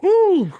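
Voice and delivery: high, loud relieved whoo; a sudden happy whoo; relieved whoop. Retold or imitated. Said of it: "I was just like".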